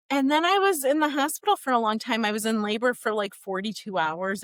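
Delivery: normal talking voice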